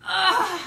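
a loud strained ugh; frustrated loud groan of effort; effortful groan. Real, not acted. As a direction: grunt as the box fights back. Struggling with packaging.

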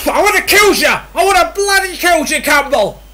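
loud furious yell at the player; raging at a missed chance; angry shout. Real, not acted. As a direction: bellow it out in rage.

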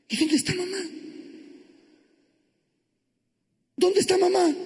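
put-on high-pitched small child's question; little child. Smallest child asking for mother, childlike voice. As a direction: ask it like a lost little one. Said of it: "Y el más chiquitito".

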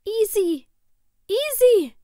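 very high, loud cry; shrieked name, pitched far up; calling from afar. A narrator's reading. Sister's taped voice from the kitchen, calling out high. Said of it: "kam von einem Tonband".

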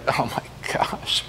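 whispered, weary sigh of disbelief; exasperated whisper. Retold or imitated. Jesus despairing at his dull disciples, whispered. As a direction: breathe it out like a head-shake. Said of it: "depicts him kinda shaking his head".

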